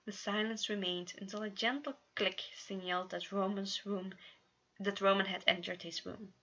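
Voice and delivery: usual speaking voice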